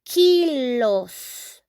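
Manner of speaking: drawn-out "kilos" with a long final s; Spanish plural pronunciation. Shown as a demonstration. Teacher stressing the plural for learners, "kilos" stretched with hissing s. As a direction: stretch "kilos" as KEEE-lohsss. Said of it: "we say".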